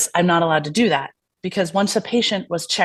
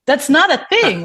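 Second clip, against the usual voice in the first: higher and louder, incredulous; exasperated retort; 8 semitones higher, 5 dB louder than usual. Retold or imitated. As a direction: push back at the absurd request, sharply raised. Retelling exasperated reply to scheduler.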